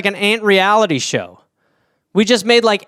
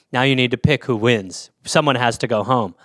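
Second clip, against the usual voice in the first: lower, softer grave announcement; stern host; 8 semitones lower, 4 dB quieter than usual. Acted. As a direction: announce the elimination solemnly. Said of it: "host announces elimination".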